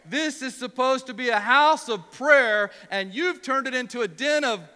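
a preacher acting out an angry Jesus; ticked off Jesus. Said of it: "he is ticked off".

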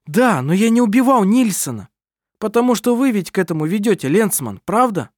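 loud, high defensive voice; agitated shouting. Acted. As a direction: push back hard. Adrian protests accusation.

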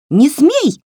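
high, loud squeal of a tiny doll; shrill doll squeal. Acted. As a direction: squeal it sharply like a furious little doll. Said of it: "взвизгнул тоненький голосок". (визгливый окрик куклы)

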